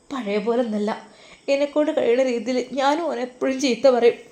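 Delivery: ordinary delivery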